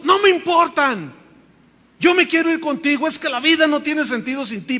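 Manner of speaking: high, loud, urgent pleading; desperate insistence. Retold or imitated. Daughter telling her father's apparition she doesn't care, loud and urgent.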